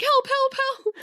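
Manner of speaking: high, hammed-up panicked help; mock cry for help. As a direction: squeal it like a damsel. Dress blown into cactus.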